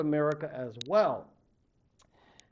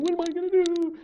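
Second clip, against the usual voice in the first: high, loud anguished cry; despairing wail; 8 dB louder, faster than usual. Acted. Boy on his hands and knees, high anguished wail. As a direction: sob it out in despair. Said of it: "he's crying".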